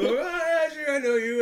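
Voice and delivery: high, laughing, cheerful "sure, I know you"; eager welcome. Retold or imitated. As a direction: greet her brightly like an old friend. Retells his eager welcome.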